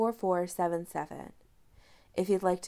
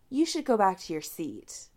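ordinary delivery, nothing marked

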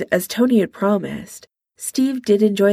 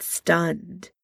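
plain reading voice

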